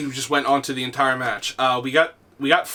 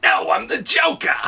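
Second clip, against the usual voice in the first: higher, theatrical villain voice; Joker; 7 semitones higher than usual. Acted. Player answers the complaining kid, Joker voice. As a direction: answer as a proud comic-book villain. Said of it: "Like that".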